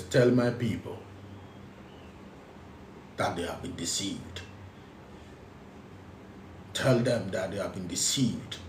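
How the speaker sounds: solemn low message. Acted. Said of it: "This is the message from the Dolphins".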